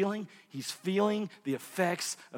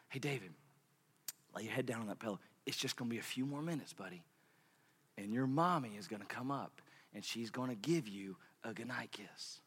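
soft bedtime comfort; 5 semitones lower, 13 dB quieter than usual. Retold or imitated. Father whispering comfort to son.

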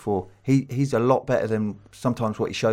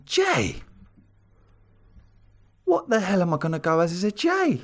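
surprised; 7 semitones higher than usual. Retold or imitated. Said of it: "he's gone".

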